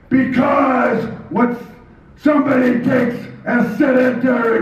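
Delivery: high, declaiming German wrestling-villain promo voice; Baron Von Raschke. Acted. Wrestler turned microphone star boasting of himself, German heel promo. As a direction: proclaim it in a high, stretched-out German accent. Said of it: "I went from little Jimmy Rashke from Nebraska".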